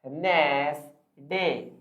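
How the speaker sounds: "वेडनेसडे" very slowly, syllable by syllable; common mispronunciation. Shown as a demonstration. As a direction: stretch "वेडनेसडे" into ved... nes... day. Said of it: "आप इसे कहते थे". ("वेडनेसडे" खींचकर, वेड-नेस-डे)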